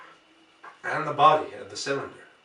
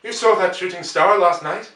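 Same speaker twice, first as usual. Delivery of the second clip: calling out; 5 semitones higher, 6 dB louder than usual. Acted. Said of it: "he called".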